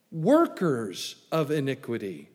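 "workers" leaned on and slowed; stress on workers. Shown as a demonstration. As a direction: hit the word "workers", slow delivery. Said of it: "I emphasize the word workers there".

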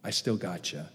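low, soft aside; sneaky fine print. Acted. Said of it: "Fine print is".

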